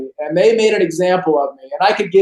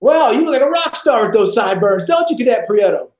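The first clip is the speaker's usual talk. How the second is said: higher sarcastic sergeant voice; mocking drill instructor; 4 semitones higher than usual. Drill instructor taunting the recruit about sideburns, sarcastic. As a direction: sneer at the rookie like a drill sergeant.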